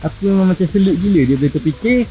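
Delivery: normal talking voice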